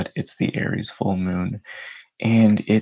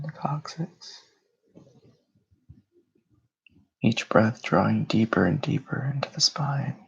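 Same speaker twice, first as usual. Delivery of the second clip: unhurried meditation voice with long pauses; guided meditation; 7 semitones higher, 4 dB louder than usual. Real, not acted. Host leading listeners through breathing and grounding, meditative delivery. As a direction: guide them into calm, phrase by phrase.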